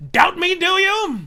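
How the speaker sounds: high, loud, drawn-out challenge; mock challenge. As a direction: throw it out slowly as a taunt. Taunts an imagined doubter.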